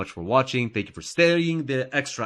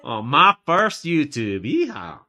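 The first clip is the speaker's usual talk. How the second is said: put-on cowboy accent; 3 semitones higher, 7 dB louder, slower than usual. Mocking cowboy American accent.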